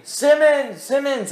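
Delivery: high-pitched retold cry of a name; imitated scream. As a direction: call out high, mimicking a desperate scream. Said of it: "he's screaming".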